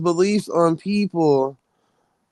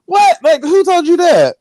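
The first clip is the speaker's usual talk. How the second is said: sharp, much higher and louder disbelief; incredulous; 10 semitones higher, 4 dB louder than usual. Retold or imitated. Host scoffing at a doomsday prophecy, loud incredulous what. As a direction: hit the what with shocked disbelief.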